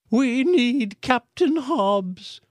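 high, slow, loud call; shouted. A narrator's reading. Aunt Cora on stage shouting to the crowd. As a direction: shout it out slowly. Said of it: "Aunt Cora shouted".

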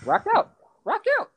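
high excited cheer. Real, not acted. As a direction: hype him up, voice jumping up. Urging friend to go on.

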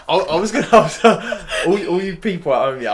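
giddy, fighting laughter; giddy and laughing; giddy anticipation. Real, not acted. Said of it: "'cause I'm giddy right now".

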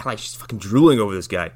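ordinary talk

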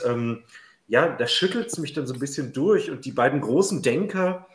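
ordinary delivery

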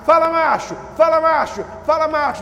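loud, high hailing call, repeated; mimicked buddy greeting. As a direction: call out like a pal greeting a mate. (imitando saudação de amigo, em voz alta)

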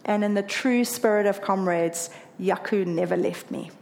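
ordinary delivery

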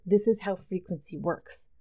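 usual speaking voice

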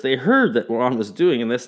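usual speaking voice

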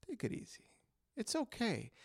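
put-on hushed, calming older man; gentle take it easy; soothing mentor. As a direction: whisper it like a calm mentor. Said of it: "he would come up to me and go".

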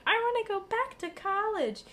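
high, longing whine; wistful whine. Retold or imitated. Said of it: "I'm watching this show, and I'm like".

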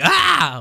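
lauter, sehr hoher Schreckensschrei; Schrei. Retold or imitated. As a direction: erschrockenes Aufkreischen. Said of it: "dann schreit sie". (Nami kreischt beim Anblick des Drachen laut auf)

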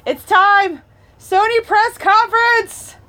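high, loud excited yelling; excited shouting. Real, not acted. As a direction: yell with excitement. Excitement, press conference starting.

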